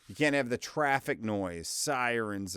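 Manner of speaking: usual speaking voice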